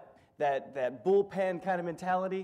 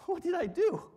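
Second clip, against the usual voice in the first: rising alarm, higher and faster; shocked; 6 semitones higher, 4 dB louder than usual. Retold or imitated. A touch of a shocked son whose mom grabs his shirt. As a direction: blurt it out, caught off guard. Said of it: "just shocked".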